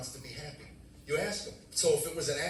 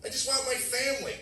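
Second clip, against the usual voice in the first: high-pitched acted plea; upset protest; 11 semitones higher, 3 dB louder than usual. Wife answering husband in argument, upset protest. Acts out wife's reply.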